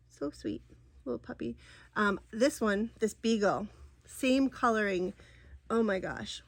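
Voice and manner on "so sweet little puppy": cooing. Real, not acted. Cooing over dog photo.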